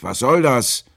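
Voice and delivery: loud, high, irritated demand; annoyed shout. A narrator's reading. Sailor yells after the fleeing boat, angry and loud. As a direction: yell it crossly across the water. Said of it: "rief ich ärgerlich".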